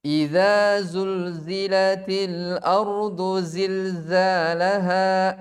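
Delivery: slow recitation buzzing every z; Quran recitation. Shown as a demonstration. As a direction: recite the verse, leaning on each za.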